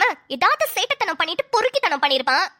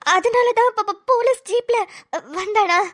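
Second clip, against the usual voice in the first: higher, halting, stunned question; shocked stammer; 3 semitones higher, slower than usual. Acted. Wife realises why he came by police jeep, shocked stammer. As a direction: stutter as it sinks in.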